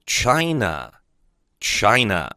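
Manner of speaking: China said slowly and clearly twice; teacher's model word. Shown as a demonstration. Teacher to students, slow careful model. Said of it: "中国就叫China".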